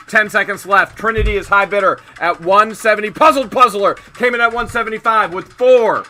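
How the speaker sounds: fast, rising auctioneer call. Real, not acted. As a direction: build the bidding to a shouting climax. Live auction countdown calling.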